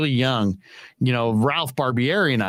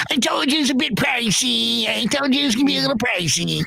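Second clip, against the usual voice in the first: high-pitched defensive impression; Ralph; 9 semitones higher than usual. Colleague excusing the costly breakfast, high impersonated voice. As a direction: protest in the colleague's high, put-on voice. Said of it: "He's like".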